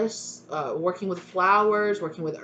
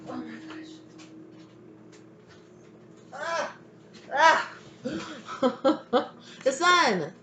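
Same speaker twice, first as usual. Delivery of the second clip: real burst of delight; excited discovery; 7 semitones higher, slower than usual. Real, not acted. Reader pulls the sun card, excited laughter.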